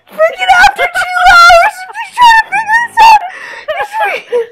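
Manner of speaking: real loud, high shriek of disbelief; high-pitched freak-out. Real, not acted. Gamer learns the fix after two hours, high-pitched freak-out. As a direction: wail it out, voice climbing high. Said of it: "[name]'s voice just hit an octave that human ears can't hear".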